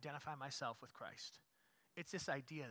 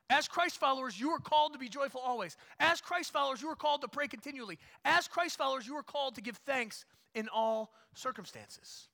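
loud, high preaching to the congregation; full-force preaching; 9 semitones higher, 11 dB louder than usual. Acted. Pastor exhorting the congregation, loud full-force preaching. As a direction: drive each line home from the pulpit.

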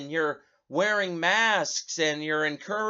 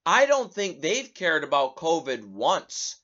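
ordinary delivery

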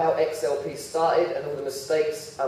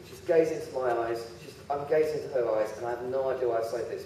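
ordinary talk

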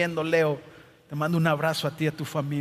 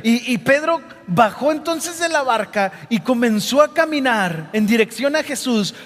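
normal talking voice

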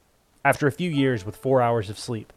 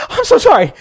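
much higher and louder, panicked; frantic apology; 13 dB louder than usual. A narrator's reading. Grandson apologising to the doctor he punched, frantic. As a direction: blurt out the apology in horror.

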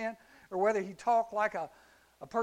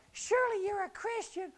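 put-on much higher little girl voice; 13 semitones higher than usual. Preacher voicing the servant girl accusing Peter, squeaky high. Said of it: "And little girl".

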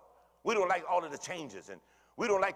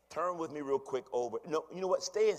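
normal talking voice

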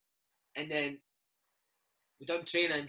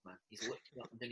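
ordinary talk, nothing marked

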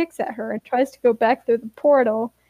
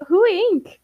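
high-pitched, simple puzzled query; childlike question; 9 semitones higher than usual. Acted. Narrator voices Ash not knowing Ink, childlike puzzlement. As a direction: ask high, like a clueless kid.

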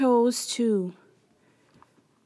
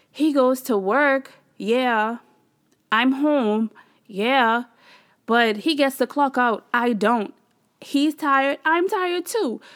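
louder, higher retold venting, point by point; fed-up rant; 4 semitones higher, 5 dB louder, slower than usual. Wife comparing her husband's work with hers, fed up. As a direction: argue it indignantly, replaying the grievance. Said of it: "I was over it all this week".

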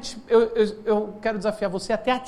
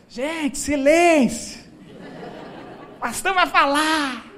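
loud, high mother-in-law announcement; calling for silence; 6 semitones higher, 4 dB louder, much slower than usual. Acted. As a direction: holler across the family table, drawing out the words. Mother-in-law calls the family to silence.

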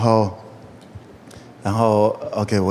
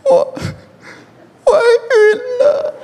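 high, slow, tearful wail; crying on the phone; much slower than usual. Retold or imitated. Girl calling her pastor late at night, crying. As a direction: sob out the news like a scared girl. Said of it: "十一点打电话在那里哭".

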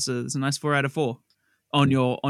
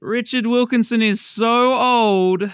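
drawn-out, higher and louder; hammed-up announcement; 6 semitones higher, 4 dB louder, slower than usual. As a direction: proclaim it like a gag catchphrase. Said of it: "Now you do that".

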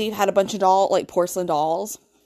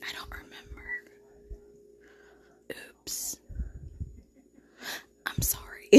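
tipsy, soft unvoiced whisper; 19 dB quieter than usual. Real, not acted. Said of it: "I'm tipsy".

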